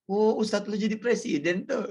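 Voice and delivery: high teasing line through a laugh; laughing tease. Retold or imitated. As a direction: laugh while mocking.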